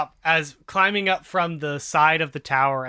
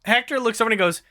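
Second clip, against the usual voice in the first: ordinary talk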